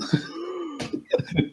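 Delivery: sharp high gasp of surprise; shocked gasp. Retold or imitated. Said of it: "you see Falcor's eyes just kinda like".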